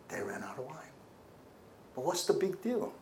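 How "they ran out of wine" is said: breathy whispered repeat of the plea; whispered worried plea. Acted. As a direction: hush it as a secret worry.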